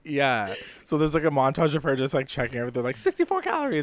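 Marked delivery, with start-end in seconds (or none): 3.0-3.8 mock Coco chirp. Voicing Coco counting calories.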